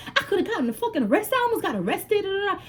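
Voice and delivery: high, exaggerated mock outrage; lying, cursing him. Scolding boyfriend with fake arrest story, high mock outrage. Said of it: "I'm lying to him".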